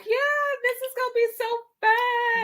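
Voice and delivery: very high, sung-out thrill; giddy sing-song. Pretend. Picturing herself up on the roof, high giddy sing-song. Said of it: "all like".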